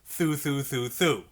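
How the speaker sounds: four thu in one breath, fingers kept down; tongue thu, thu, thu, thu smoothly on one breath. Shown as a demonstration. Teacher to student, demoing four notes in one breath. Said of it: "blow one breath and go thu four times".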